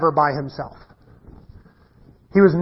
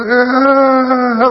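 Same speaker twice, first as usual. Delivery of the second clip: short grunted "Ugh", a bit higher; weird groan; 6 semitones higher, 4 dB louder than usual. Retold or imitated. Scared son in a safe valley, imitated weird grunt. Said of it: "like this weird".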